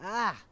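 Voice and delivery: sudden high yelp as he trips; high startled cry. Acted. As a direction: yelp while falling. Jim falls over.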